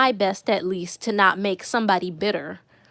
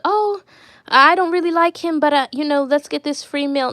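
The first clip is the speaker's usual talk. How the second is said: higher, louder mimicking voice; mock free-meal dater; 4 semitones higher, 3 dB louder than usual. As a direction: mimic a woman talking herself into it. Mimics women who date for meals.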